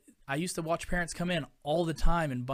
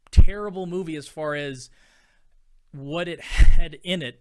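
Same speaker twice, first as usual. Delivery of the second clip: laughing emphasis; 2 semitones higher, 17 dB louder than usual. Real, not acted. Ranting about movie content.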